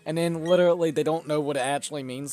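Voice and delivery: ordinary talk, nothing marked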